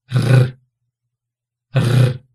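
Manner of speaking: R rolled with the tongue tip vibrating; trilled R. Shown as a demonstration. Teacher demonstrating a rolled R to students. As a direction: roll the R hard on the palate. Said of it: "produzindo esse som aqui, ó".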